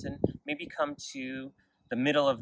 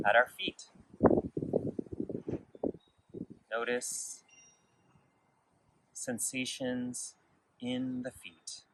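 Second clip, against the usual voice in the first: guided meditation; 4 dB quieter than usual. Real, not acted. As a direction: lead gently through the body, unhurried and quiet. Leads a body scan meditation.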